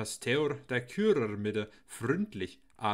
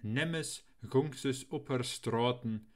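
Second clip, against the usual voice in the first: usual speaking voice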